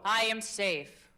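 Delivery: slow, deliberate, calm statement; slow affirmation. Shown as a demonstration. Coach leads the class in a slow affirmation. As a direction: let each word land slowly. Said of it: "affirmation repeated by class".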